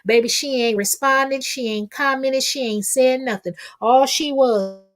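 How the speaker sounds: usual speaking voice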